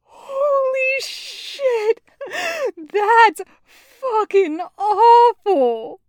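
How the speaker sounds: high, slow squeal through laughter; acted laughing to tears. A narrator's reading. Taco hearing the terrible accent, laughing to tears. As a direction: drag the words out while cracking up. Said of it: "got tears in his eyes from laughing".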